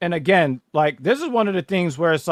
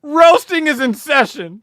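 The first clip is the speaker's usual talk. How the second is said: hype announcement; 12 semitones higher, 9 dB louder, slower than usual. Real, not acted. Announcing the roast.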